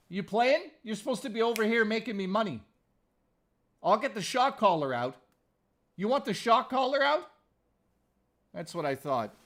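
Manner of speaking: hammed-up menace at the cat; mock threat to cat. Real, not acted.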